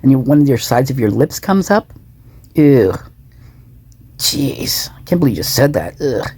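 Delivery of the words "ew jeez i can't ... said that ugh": sneering, lip curled, mock disgust. Shown as a demonstration. Vlogger demonstrating a sneer to viewers, mock disgust. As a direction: show the sneer with a lightly faked ew. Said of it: "Something like that".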